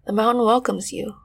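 calm, unhurried meditation guide voice; guided meditation. Acted. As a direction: soothe them gently into the scene.

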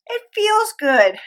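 high, loud, drawn-out delight; delighted exclamation. Real, not acted. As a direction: stretch it out high and gleeful. Exclaiming the joy of heart living.

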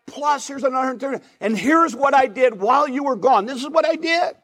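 a servant bursting to show off his doubled money; excited servant. Acted. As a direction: present the money like a proud, thrilled kid. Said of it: "He is so excited".

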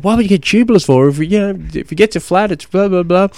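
high, fast mock of nagging mates; a hammed-up scoffing cyclist. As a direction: whine it like a know-it-all mate. Mates' backlash imitated.

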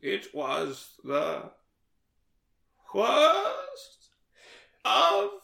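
hammed-up, drawn-out high declaiming; mock-dramatic recital. Host mock-reciting a famous novel opening, hammed-up and drawn out.

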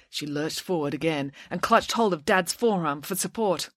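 ordinary delivery

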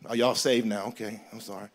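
low, soft, mock-contrite aside; sheepish apology. Pastor begging forgiveness after fighting words, mock-contrite. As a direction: drop right down into a sheepish little prayer.

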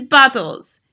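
loud, high shout. A narrator's reading. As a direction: shout it out at the room. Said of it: "she shouted".

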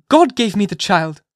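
high, loud mother's cry. A narrator's reading. Hester to the magistrates, crying out for her child. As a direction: fling the words out in defiance. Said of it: "cried she".

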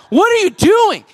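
high-pitched incredulous scolding; hammed-up disbelief at an overeager parent; mock exasperation.

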